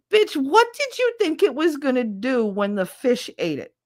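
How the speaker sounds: high-pitched, incredulous comeback; exasperated retort. Retold or imitated. Speaker answering squeamish friend, exasperated. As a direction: fire back at a silly accusation, acted out.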